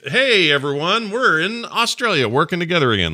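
imitating a delighted colleague returning to the office; thrilled coworker. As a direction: cheer it like you've been freed. Stoked coworkers back at office.

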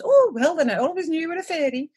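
high, breezy imitation; mock easy acceptance. Imitating Ian accepting time travel, mock breezy acceptance. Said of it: "he does take it very well".

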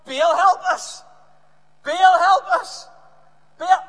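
very loud, high acted shout. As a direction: bellow a drawn-out plea. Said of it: "they called out to Baal".

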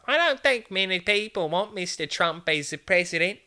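put-on earnest little boy; little kid voice. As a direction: sound like a confident seven-year-old. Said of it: "there's a little kid".

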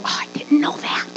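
whispered fake astonishment; hushed, hammed-up surprise; mock-shocked God. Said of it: "He didn't go".

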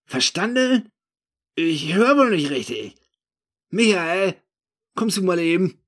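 high, loud incredulous retort turning into a call; loud challenge. Acted. Drunk narrator calling his friend for backup, loud challenge. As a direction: challenge him, then call a friend over.

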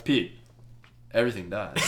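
low and hushed, mock-solemn; grave punchline. Podcaster reprising the spider's verdict to Pete, mock-grave. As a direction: drop it softly, pausing after the name.

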